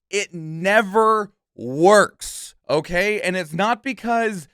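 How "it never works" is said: slow it right down and hammer the point; slow emphatic stress. Real, not acted. Warning viewers, slow and emphatic. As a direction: drag out it never works slowly, leaning hard on never. Ranting warning about fan-driven games.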